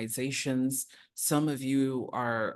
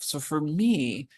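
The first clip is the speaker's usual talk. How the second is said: usual speaking voice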